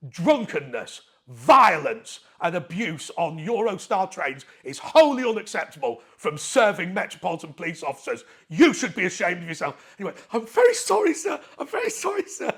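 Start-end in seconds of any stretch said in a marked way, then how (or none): 0.1-10.0 stern telling-off. Retold or imitated. Caller scolding the police officer, loud stern rebuke. 10.3-12.5 much higher, loud mock whimper of sorry; grovelling apology. Officer apologising to the caller, mock high grovel.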